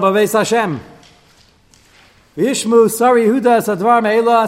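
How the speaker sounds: loud, high, declaiming the verse; reading the verse aloud with emphasis; scripture reading. Acted. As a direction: proclaim the Hebrew text to the class. Reads Hebrew verses aloud.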